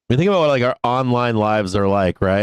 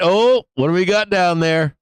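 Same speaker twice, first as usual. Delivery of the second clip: normal talking voice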